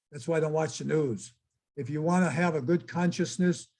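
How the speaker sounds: ordinary talk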